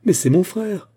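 high, loud indignant protest; pained angry cry. A narrator's reading. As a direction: cry out in wounded outrage. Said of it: "cria-t-elle avec la colère douloureuse".

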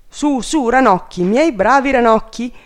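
rally the frogs urgently; calling out. A narrator's reading. King summons his frogs.

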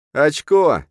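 a sudden high, loud shout of triumph. A narrator's reading. Hero shouting over found money, loud and high. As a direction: yell it out as the cash appears. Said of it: "крикнул я".

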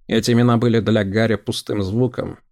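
ordinary delivery, nothing marked

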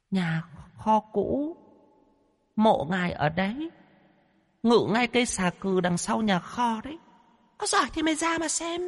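high, soft, ghostly demon voice; eerie high-pitched menace from a spirit; haughty female demon. Acted. Female demon warns the exorcist about her master, eerie menace.

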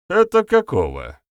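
high, loud show of not knowing; feigned ignorance. A narrator's reading. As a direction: play dumb with an exaggerated question. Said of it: "наигранно спросил Гостята".

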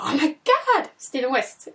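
high squeal on "Oh my god"; starstruck. Retold or imitated. A fan recognizes the designer, squealing high and loud. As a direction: gasp his name like a starstruck fan. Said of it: "Jag var jättestarstruck".

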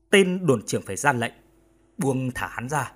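ordinary delivery, nothing marked

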